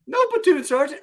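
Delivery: very high, loud barked reply; mock recruit denial. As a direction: deny it like a recruit at attention. Recruit denies it loudly.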